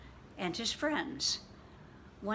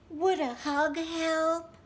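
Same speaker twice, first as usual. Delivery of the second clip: gentle offer; 9 semitones higher, 5 dB louder, slower than usual. Acted. Gentle rabbit offers hug.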